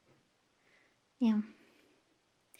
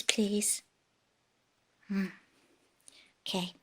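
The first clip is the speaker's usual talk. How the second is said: soft whispered plea; 7 semitones lower, 14 dB quieter than usual. Real, not acted. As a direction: breathe the word gently. Asking cat not to eat leaves.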